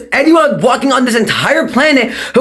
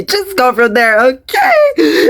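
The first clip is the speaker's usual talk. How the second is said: breaking into tears; 2 semitones higher, slower than usual. Acted. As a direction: crack into crying while giving instructions. Teacher breaks down crying mid-class.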